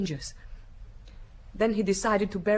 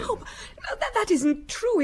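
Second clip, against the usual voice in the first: a breathless gasp, then a higher, louder protest; distressed denial; 11 semitones higher, 3 dB louder than usual. Acted. Sister to the inspector, denying her brother fled, in rising distress. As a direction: refuse to believe it, voice jumping up.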